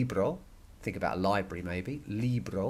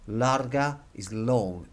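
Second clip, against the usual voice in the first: normal talking voice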